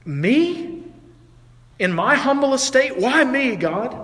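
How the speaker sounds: high-pitched, astonished questioning; incredulous humility. Acted. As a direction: ask God in humble disbelief. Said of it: "she's probably thinking".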